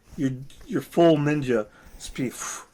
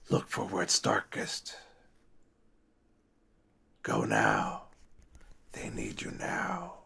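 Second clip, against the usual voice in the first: urgent hushed warning; whispering old lady; 6 semitones lower, 10 dB quieter than usual. Acted. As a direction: whisper the danger to them. Old lady NPC guidance.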